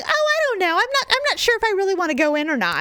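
high, dithering indecisive voice; mock dithering. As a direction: play a dawdler who can't decide. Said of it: "It's not like I'm sitting here going".

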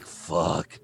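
low, quiet, drawn-out swear; muttered dismay; deflated cursing. Retold or imitated. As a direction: sigh it out in defeat. Deflated reaction to a rejection.

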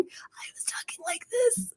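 high, slow, whispered squeak; voice barely back. Retold or imitated. Interviewee after choking on water, tiny strained whisper. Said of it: "when I finally got my voice".

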